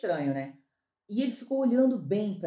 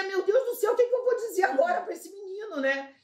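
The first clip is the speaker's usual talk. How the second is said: higher desperate inner cry; 7 semitones higher than usual. Retold or imitated. Recalling her despair beside the stranger, a higher desperate cry. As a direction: replay the moment of despair, half amused. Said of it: "e eu desesperada".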